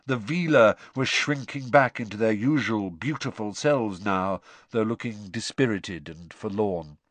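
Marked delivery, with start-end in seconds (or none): none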